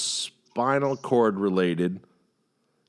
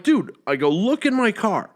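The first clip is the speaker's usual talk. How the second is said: exasperated plea; 10 semitones higher, 4 dB louder than usual. Retold or imitated. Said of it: "I'm like".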